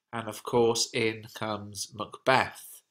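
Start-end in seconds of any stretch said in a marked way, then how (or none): none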